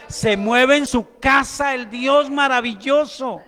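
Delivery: loud, emphatic preacher's proclamation; full-force preaching. Real, not acted. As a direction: preach it out loudly to a whole congregation.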